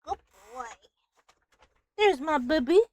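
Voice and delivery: high, soft coo for the cat. Real, not acted. Streamer coos to her cat in a high baby voice.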